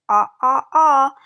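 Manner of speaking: playful dentist ah, loud and high; loud mock ah. Shown as a demonstration. Teacher acting the dentist ah sound, loud and high. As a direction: open wide and shout the ah sounds.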